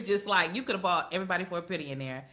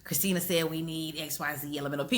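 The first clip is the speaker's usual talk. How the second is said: Letty; 3 semitones lower, 5 dB quieter than usual. Retold or imitated. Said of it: "she's just kinda like".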